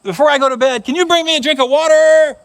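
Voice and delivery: put-on high, loud child pleading from bed; whiny kid calling out to dad; little boy calling Dad. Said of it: "the little boy said".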